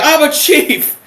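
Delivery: high, loud imitated outburst; exasperated mock bellow; fed-up chief's yell. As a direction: snap and shout it like a fed-up chief. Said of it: "so fucking fed up with being".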